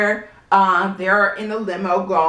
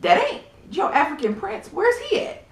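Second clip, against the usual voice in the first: imitating Portia; 10 semitones higher than usual. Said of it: "And Portia's like".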